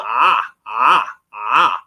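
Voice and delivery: Ah drawn from the back of the throat, low; gargled Ah from deep in the throat. Shown as a demonstration. Teacher showing a tone to online students, throaty ah. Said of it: "it's like the back of the throat".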